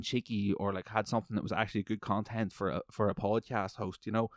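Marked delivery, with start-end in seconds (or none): none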